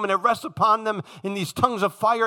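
ordinary talk, nothing marked

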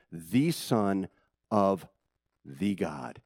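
"the Son of the God" said slowly, word by word; slow, spaced out. Shown as a demonstration. Teaching church, slow spaced out words. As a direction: land hard on each word of "the Son of the God". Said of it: "All singular, genitive, possessive, singular".

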